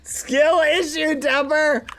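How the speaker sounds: high, slow taunting singsong; mocking singsong. Real, not acted. Streamer teasing a chat member Dapper, high mocking singsong. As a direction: stretch it out to tease.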